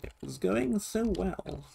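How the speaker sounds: high, slow, whiny sigh of defeat; mock lament. Real, not acted. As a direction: moan it like everything was ruined. Puzzle attempt just failed.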